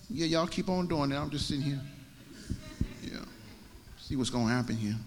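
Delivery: soft, offhand murmur; acted nonchalant waiting. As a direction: toss it off quietly as an idle onlooker. Elijah idly waits, acted.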